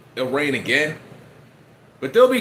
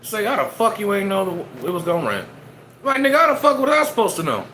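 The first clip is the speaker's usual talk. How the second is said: exasperated rant; 7 semitones higher than usual. Retold or imitated. Arguing with himself in his head about the rain, indignant outburst. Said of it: "In my head, I'm like".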